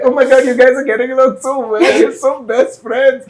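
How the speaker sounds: gushing excitement. Acted. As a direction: gush over them like a fan. Gushing about guys getting along.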